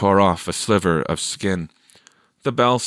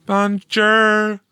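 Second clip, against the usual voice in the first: put-on girl's bonjour; 12 semitones higher, 5 dB louder than usual. A narrator's reading. Teresa says goodbye.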